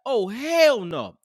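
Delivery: slow, drawn-out oh hell no; slow indignant oh hell no; said out loud. Retold or imitated. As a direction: stretch it out in disbelief. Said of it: "I said out loud".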